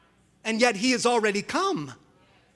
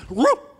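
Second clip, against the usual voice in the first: imitated dog yelp; 4 semitones higher, 6 dB louder than usual. Dead dog suddenly yelps.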